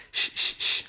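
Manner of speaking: whispered lisping shh from a retainer; retainer lisp hiss. Shown as a demonstration. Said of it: "so I may sound a little".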